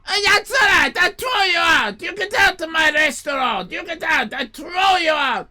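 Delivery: high, loud furious shouting; angry owner. Retold or imitated. Owner shouting at customers to get out. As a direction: roar them out of the place. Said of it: "owner throws them out".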